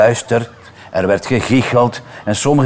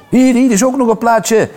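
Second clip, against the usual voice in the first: put-on high, excited child's call; boy waving to Stella, high excited call; eager boy; 12 semitones higher, 3 dB louder than usual. A narrator's reading. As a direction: wave him over eagerly. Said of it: "wuifde een jongen".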